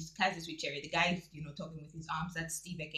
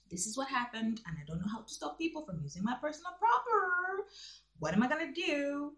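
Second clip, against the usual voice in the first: lightly acted plea, rising higher; mock complaint; 6 semitones higher than usual. Upset worker complaining to the manager about her property, mock complaint. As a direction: plead your case with rising pitch.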